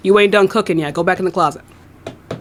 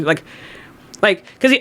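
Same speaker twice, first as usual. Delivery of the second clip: ordinary talk, nothing marked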